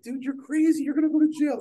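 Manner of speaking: high, loud mock of a friend scolding; high, loud imitated warning. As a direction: squeak it out like the worried buddy. Friend warns him.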